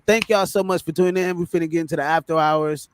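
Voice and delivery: usual speaking voice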